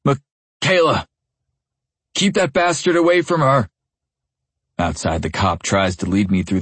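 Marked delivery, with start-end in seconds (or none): 0.0-3.7 yelling. A narrator's reading. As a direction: yell across the room for her.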